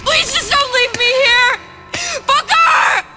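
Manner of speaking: high, loud, terrified begging; desperate pleading scream. Acted. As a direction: scream it, panicked and alone. Begging him not to leave.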